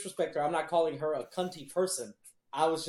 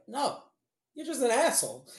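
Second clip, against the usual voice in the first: Doctor Phil, retold; 8 semitones higher, 4 dB louder than usual. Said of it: "Doctor Phil's like".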